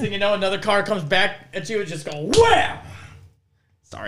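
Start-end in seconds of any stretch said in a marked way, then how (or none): 2.4-2.8 a sudden shouted crash sound effect blasted high; loud mock crash. While telling of a car coming at you, a shouted crash. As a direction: yell the impact noise into the mic. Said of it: "Why would you assault the microphone".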